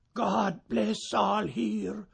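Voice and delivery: slow, lightly voiced old wanderer; a hint of a weary traveller calling out a blessing; understated old man's blessing at the door; old wanderer's greeting. A narrator's reading. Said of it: "the wanderer cried".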